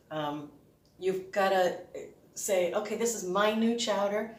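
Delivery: ordinary talk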